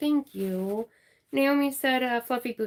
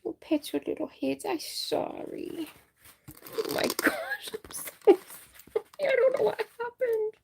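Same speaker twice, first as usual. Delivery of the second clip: cooing apology; 12 semitones higher than usual. Real, not acted. Cooing apology to startled viewers.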